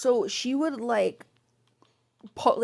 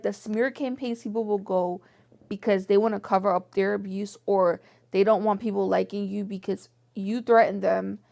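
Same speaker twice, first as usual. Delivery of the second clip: ordinary talk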